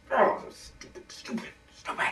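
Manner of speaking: whispering stupid to himself; whispered self-scolding. Acted. Alone after the call ends, whispered self-reproach. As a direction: scold yourself in a hush.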